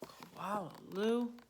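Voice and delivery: plain reading voice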